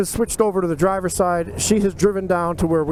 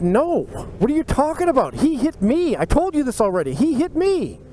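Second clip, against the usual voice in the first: indignant protest; 7 semitones higher than usual. Retold or imitated. Insisting to cop he was hit.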